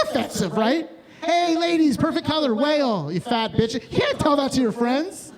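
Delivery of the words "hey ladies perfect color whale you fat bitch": mock bride voice.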